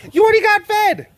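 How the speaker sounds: very high, loud put-on voice; comic falsetto protest; mock scolding squawk. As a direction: squawk it at a greedy pet.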